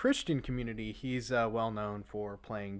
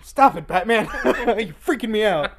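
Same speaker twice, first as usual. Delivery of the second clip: loud, high, laughing mock panic; mock freaked-out laugh; 10 semitones higher, 15 dB louder than usual. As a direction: play spooked through laughter. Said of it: "if you hung out with Batman".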